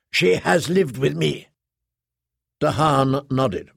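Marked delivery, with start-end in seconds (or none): none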